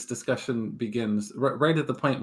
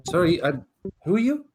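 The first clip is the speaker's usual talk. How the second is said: a startled bunny stranger, slightly higher; a lost bunny asking who everyone is; confused bunny person; 6 semitones higher, 4 dB louder, faster than usual. Acted. Game master voicing a teleported bunny, confused.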